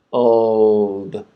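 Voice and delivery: isolated Er held out; er sound. Shown as a demonstration. Teacher isolating the Er sound, drawn out. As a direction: say Er with the tongue pulled back. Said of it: "the tongue goes up for the L sound".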